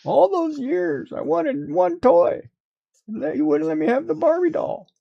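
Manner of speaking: put-on high whiny child pleading; whiny kid voice. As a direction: play a sulky kid begging mom. Mock child pleading with mom.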